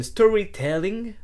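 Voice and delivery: storytelling said in a put-on English accent; slow English-accented storytelling. Shown as a demonstration. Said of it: "vous avez vu mon accent anglais".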